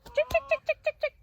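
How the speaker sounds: high, loud chicken call; calling a hen. Real, not acted.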